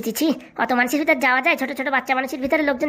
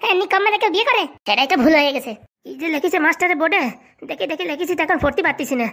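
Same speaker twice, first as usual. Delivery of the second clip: normal talking voice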